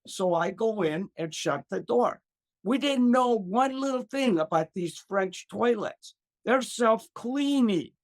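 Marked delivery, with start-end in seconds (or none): none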